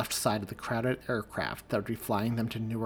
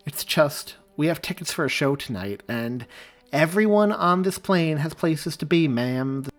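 cutting in firmly, raised pitch and louder; firm interruption; 4 semitones higher, 7 dB louder than usual. A narrator's reading. Stewardess firmly cutting off a complaining passenger, raised firm voice. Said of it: "cut across her firmly".

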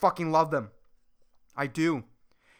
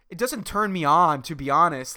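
usual speaking voice

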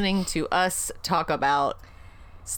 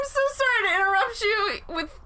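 disgusted. Real, not acted. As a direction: squeal out your disgust. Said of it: "peals of disgust".

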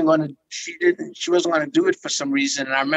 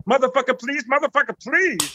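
higher, pleading character voice from the movie; 7 semitones higher than usual. Retold or imitated. Recalling his movie role, a pleading character voice. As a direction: beg like the character, pitched up. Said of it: "I was talking like this".

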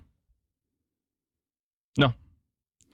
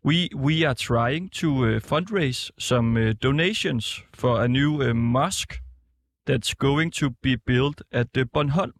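slower for a confused listener; slow repeat; slower than usual. Real, not acted. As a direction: spell it out slowly. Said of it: "Can you repeat again".

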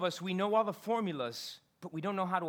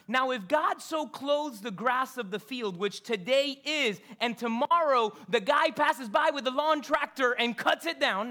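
slow, louder preaching read; scripture reading; 5 semitones higher, 6 dB louder than usual. Acted. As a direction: declaim the verse with conviction. Reading verse with emphasis.

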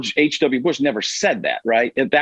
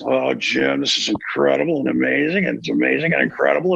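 gushing Tony Romo broadcaster voice; Tony Romo impression gushing to Jim in the booth. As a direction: rave to Jim like an overexcited color man. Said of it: "the Tony Romo bit is".

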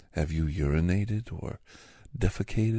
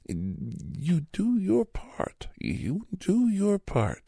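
much higher and very slow, drawn out; sing-song praise; 13 semitones higher, much slower than usual. Acted. Clerk sweetly praising a murder victim, high and slow. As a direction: coo it like praising a child.

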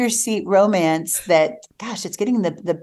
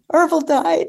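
mock upset; 9 semitones higher, 4 dB louder than usual. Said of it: "I was so upset".

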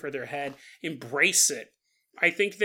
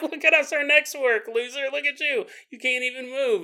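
mocking kids; 8 semitones higher than usual. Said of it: "They're like".

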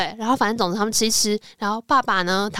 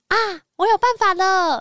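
Doraemon's idea; 9 semitones higher than usual. Retold or imitated. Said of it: "哆啦 A 梦就说".

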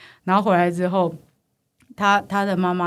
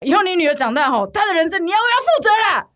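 indignant mother; 10 semitones higher, 4 dB louder than usual. Retold or imitated. Mother's parting threat.

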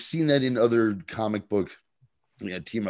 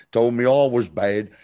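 bad Cockney accent; 5 dB louder than usual. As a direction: belt out a hammed-up, badly faked Cockney. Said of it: "had the shitty Cockney accent".

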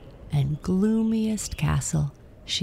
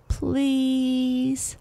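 much higher, pleading please; begging; 9 semitones higher than usual. A narrator's reading. Astrid to the east wind, begging high. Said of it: "Astrid begged".

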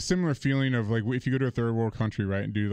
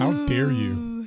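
slow, drawn-out fake indignation; mock outrage; 3 semitones higher, 3 dB louder, much slower than usual. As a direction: gasp it out like a scandalised aristocrat. Friend's mock outrage over coffee.

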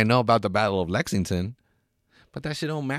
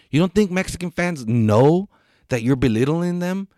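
heated rant; 6 semitones higher, 6 dB louder than usual. Real, not acted.

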